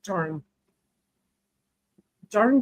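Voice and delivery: ordinary delivery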